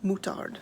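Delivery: moutarde said moo-TARD with a flat American r; American-accented moutarde. Shown as a demonstration. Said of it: "my American accent way of saying it".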